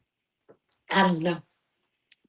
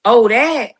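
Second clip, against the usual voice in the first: mocking quote; slower than usual. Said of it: "for you to sit up here and talk about".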